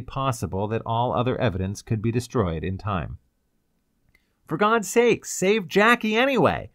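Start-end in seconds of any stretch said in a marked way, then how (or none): 4.5-6.7 high, loud begging; desperate plea. A narrator's reading. Ryder prays the rescuer will save Jackie, desperate. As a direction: beg urgently for her life. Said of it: "Ryder prayed".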